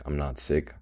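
usual speaking voice

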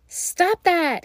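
sharp loud high cry to scare the bird. Acted. Hansel shouts at the bird pecking his bread trail, loud and high. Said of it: "he cried".

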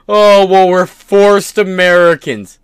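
high, loud mocking whine of a crybaby; mock crying voice. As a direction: sneer it like a whining complainer. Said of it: "you go around crying".

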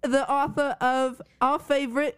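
hammed-up British accent with a laugh in it; playful mock-British lilt. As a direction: tease in a put-on British accent. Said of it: "about your British accent".

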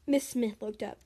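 usual speaking voice

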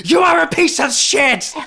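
high, loud venomous shriek; mock screaming tirade, way too early in the morning; screamed insult. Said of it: "being like".

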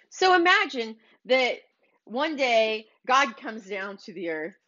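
usual speaking voice

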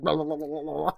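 rapid high babbling as if being electrocuted; mock shock-jolted tongue wobble; electrocution noise. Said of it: "Ulrich gets electrocuted and".

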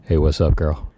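deep fake guy voice. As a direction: drop low and play it cool. Pretending to be Jordan, deep.

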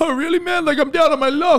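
down on his luck voice; high grateful stranger. Retold or imitated.

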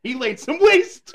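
loud, laughing; laughing shout. Real, not acted. Laughing joke after a pun.

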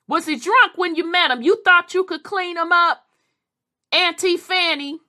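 high, loud teasing questions; questioning Auntie Fanny. Acted. Niece grilling Auntie Fanny about drunk husband, high loud teasing. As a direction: call out to her like nosy family.

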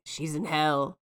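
a hint of a possessed child's menace; creepy deadpan. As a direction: say it flat like a possessed girl. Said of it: "Loretta's like".

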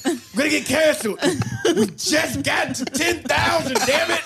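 high, laughing mock panic; laughing quote. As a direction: squeal it out laughing. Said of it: "She said".

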